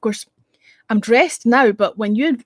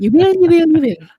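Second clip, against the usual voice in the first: loud, insistent Irish mammy; higher, louder Irish insistence; southern Irish accent; 7 semitones higher, 7 dB louder than usual. Pretend. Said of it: "I don't talk like that, like I come from the south of Ireland".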